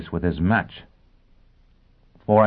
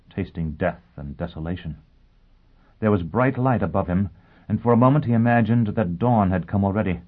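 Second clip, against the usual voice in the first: plain reading voice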